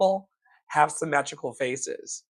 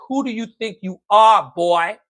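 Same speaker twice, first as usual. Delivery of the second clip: higher, louder imitation of a scornful father; father's scornful voice; 5 semitones higher, 7 dB louder than usual. Speaker voicing his late father belittling him as a boy, loud and scornful. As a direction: bark it down like a dad belittling his son. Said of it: "hear my father and myself say".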